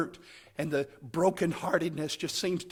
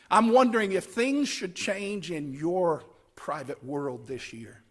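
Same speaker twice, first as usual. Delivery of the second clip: ordinary talk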